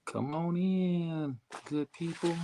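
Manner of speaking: slow, high drawn-out welcome; drawn-out high host greeting; welcoming call. Real, not acted. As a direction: sing out the welcome to the crowd.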